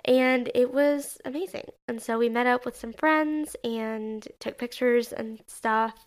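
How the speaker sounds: normal talking voice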